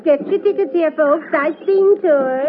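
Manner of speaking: high, slow barker's call to passing folks; calling out to crowds. Acted. Tour seller hawking tickets to passersby, high slow call. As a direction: sing out the tour pitch to the crowd.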